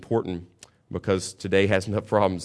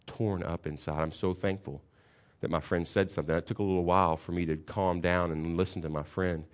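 usual speaking voice

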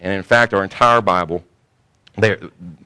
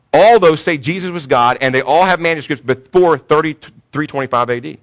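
emphatic; 6 semitones higher, 7 dB louder than usual. Real, not acted. Preacher restating the claim more forcefully.

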